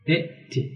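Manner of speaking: ائتي begun with a short i sound; initial kasra. Shown as a demonstration. Teacher shows students the imperative, ائتي said with initial kasra. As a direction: open ائتي on an i vowel, softly. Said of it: "هم بالكسر".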